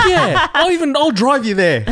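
eager offer broken by laughter; laughing mock offer. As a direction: play along eagerly while cracking up. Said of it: "my mate's like".

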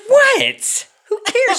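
very high exaggerated squeak; mock high squeal. Retelling his reply to the wife, very high squeak. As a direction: squeak it out in fake disbelief. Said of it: "I'm like".

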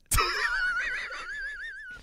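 high-pitched giggle; real high laugh; high squeal laugh. Real, not acted. Laughing at own joke.

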